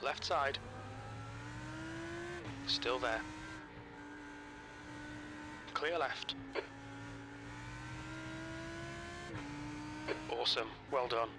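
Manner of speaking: clipped radio over engine roar. Real, not acted. Race engineer radio calls mid-race.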